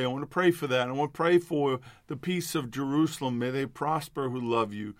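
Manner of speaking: normal talking voice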